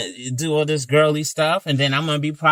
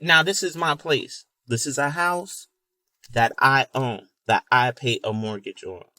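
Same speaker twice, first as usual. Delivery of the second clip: ordinary delivery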